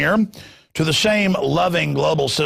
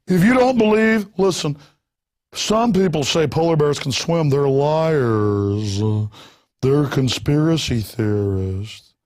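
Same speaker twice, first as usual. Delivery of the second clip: sarcastic mock; slower than usual. Mocking the alarmists.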